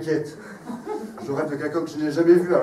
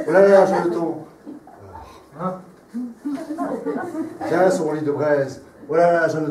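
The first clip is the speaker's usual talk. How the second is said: declaimed like a Roman emperor; grand tragic stage delivery. Shown as a demonstration. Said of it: "Non, c'est pas ça".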